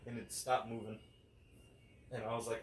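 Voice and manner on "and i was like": ordinary talk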